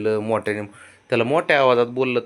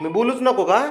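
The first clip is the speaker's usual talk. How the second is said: high, louder coaxing plea; pleading question; 11 semitones higher, 5 dB louder than usual. Real, not acted. Father pleading with sulking child. (उंच आवाजात आर्जवी प्रश्न)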